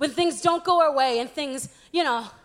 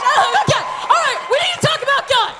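laughing; 10 semitones higher, 5 dB louder, faster than usual. Real, not acted. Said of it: "laughing restart".

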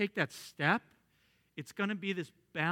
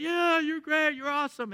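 high excited crowd cheer; 11 semitones higher, 4 dB louder than usual. Retold or imitated. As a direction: cheer him on like a fan. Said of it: "they'd all go crazy".

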